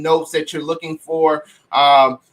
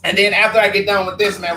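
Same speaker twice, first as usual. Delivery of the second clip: ordinary talk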